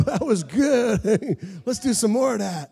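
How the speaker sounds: high, laughing shout of joy; laughing delight. Retold or imitated. Pastor laughing to his church about the prayer's result, delighted. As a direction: laugh out the words, thrilled. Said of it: "I was like".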